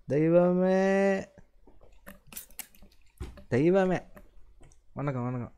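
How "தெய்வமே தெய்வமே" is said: high, long drawn-out hail; calling out. Real, not acted. Streamer hailing a viewer who joined, calling out high. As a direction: hail them with a stretched, raised call.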